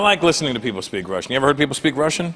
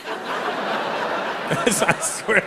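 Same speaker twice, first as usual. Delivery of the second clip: normal talking voice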